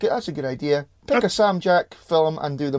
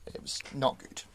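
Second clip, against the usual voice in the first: whispered; 13 dB quieter than usual. Real, not acted. Mumbled verdict.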